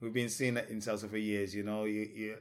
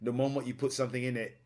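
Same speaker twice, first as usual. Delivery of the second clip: usual speaking voice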